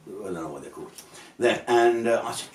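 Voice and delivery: usual speaking voice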